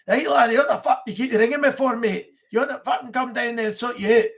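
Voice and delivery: hammed-up angry Scottish rant; angry Scottish accent. Warning off a spam caller on the phone, angry Scottish accent. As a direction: threaten in a thick, irate Scottish accent. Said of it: "I've done angry Scottish accent on it".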